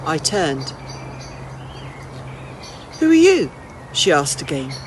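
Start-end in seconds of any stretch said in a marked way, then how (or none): 3.0-3.5 put-on high, loud, startled old woman demanding answers; an old woman calling out sharply at an intruder; sharp old lady challenge. Old lady repeats her question.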